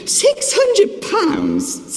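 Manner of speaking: hundred pulled up very high and slow, then pounds; emotional stress on hundred. Real, not acted. To the congregation, lamenting the bill with a very high, slow hundred. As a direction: wail the hundred out in pained disbelief. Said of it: "you can see that I'm quite emotional".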